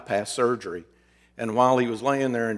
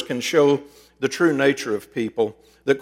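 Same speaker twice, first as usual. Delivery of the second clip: normal talking voice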